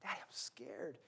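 put-on whispered, frightened little girl; softly voicing a scared daughter; scared child. Daughter at the rope bridge, whispered fear. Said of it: "And she goes".

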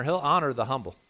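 ordinary talk

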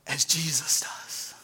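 soft, hushed, slow; awed whisper. Real, not acted. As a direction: let it land in a whisper. Sermon punchline dropped to whisper.

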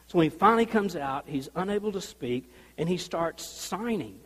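ordinary delivery

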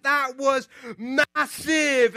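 mimicking a kid's huge excitement; excited. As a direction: draw it out in childlike awe. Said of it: "He got so excited".

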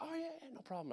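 high, very soft feigned nonchalance; masking panic. Retold or imitated. Soldier told of Iraq deployment, hiding panic. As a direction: squeak out that all is fine. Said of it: "not visibly freaking out".

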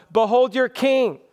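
mocking. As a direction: toss it out as a jibe at the crowd. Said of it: "effort of Pilate to mock".

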